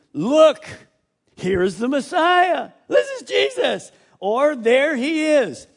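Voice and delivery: high, loud shout of discovery; excited false claim. Acted. Deceiver shouting to crowds, excited and loud. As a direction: announce the Messiah breathlessly.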